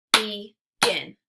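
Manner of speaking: clapped-out syllables. Shown as a demonstration. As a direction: Begin as loud "be... gin". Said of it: "clap it out into syllables".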